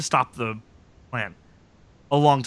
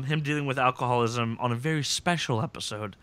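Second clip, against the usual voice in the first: ordinary talk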